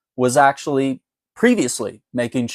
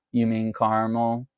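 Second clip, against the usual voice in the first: unenthused barista; 2 semitones lower, 6 dB quieter than usual. Retold or imitated. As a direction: imitate a goth barista who couldn't care less. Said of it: "She's just unenthused with her life".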